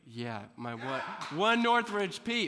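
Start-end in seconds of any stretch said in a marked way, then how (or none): 1.3-2.5 laughing through the words, slow; amused chuckle while speaking. Real, not acted. Laughing at the lone schoolmate.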